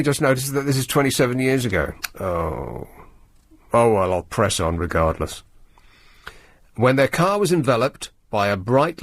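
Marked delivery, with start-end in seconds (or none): none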